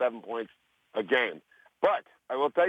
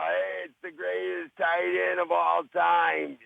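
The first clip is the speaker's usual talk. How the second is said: slow, drawn-out, higher-pitched fake recognition; big slow mock-admiring greeting; gushing movie star; 5 semitones higher, much slower than usual. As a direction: hail a legend you pretend to know, stretching every word. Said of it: "he was acting like he knew".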